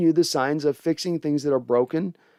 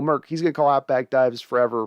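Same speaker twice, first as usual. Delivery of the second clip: ordinary talk, nothing marked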